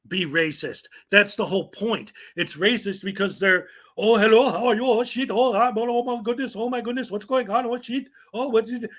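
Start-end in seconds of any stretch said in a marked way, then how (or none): 4.0-8.8 higher, hammed-up mock flustered babble; mock imitation. Vlogger mocking auditors' fake accent to strangers. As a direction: parody their exaggerated fake voice. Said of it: "That's all they do".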